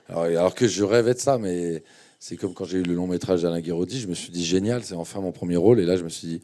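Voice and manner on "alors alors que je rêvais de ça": ordinary talk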